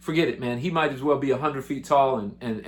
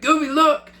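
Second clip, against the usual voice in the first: high, loud excited yell; excited shout; 12 semitones higher, 5 dB louder than usual. Retold or imitated. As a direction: wake your friend up, thrilled. Jesse waking friend at sight.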